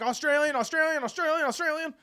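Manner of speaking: high, loud, hurried repetition; mock-panicked high explanation; frantic excuse. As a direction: repeat it urgently to smooth things over. Frantic explanation to the shocked girl.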